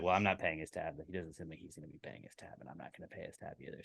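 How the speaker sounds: muttered between friends; quiet aside. Retold or imitated. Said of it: "just quietly".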